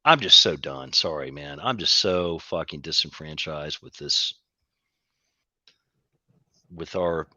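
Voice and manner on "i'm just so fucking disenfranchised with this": ordinary talk, nothing marked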